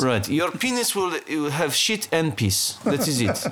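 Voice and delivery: put-on deliberate Russian-accented pronouncement; put-on Russian heavy; mock Russian accent.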